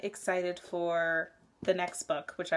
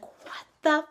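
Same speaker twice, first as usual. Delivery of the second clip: baffled; 6 semitones higher, 3 dB louder, slower than usual. Retold or imitated. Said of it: "And it's like".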